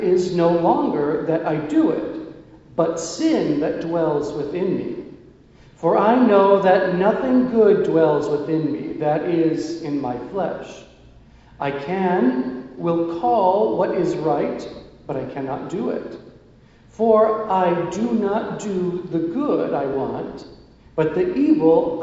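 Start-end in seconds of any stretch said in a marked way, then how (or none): none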